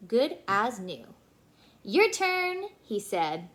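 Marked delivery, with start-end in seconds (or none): 1.8-2.6 high, louder, bright call; cheerful invitation. Acted. Cheerful dentist invites sister.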